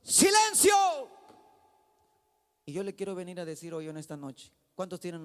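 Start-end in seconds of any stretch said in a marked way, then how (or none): 0.0-0.7 loud, high command to the storm; shouted rebuke. Acted. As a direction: shout it as Jesus silencing the waves. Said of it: "reprendió al viento y dijo a las olas". (grito de reprensión, fuerte y agudo)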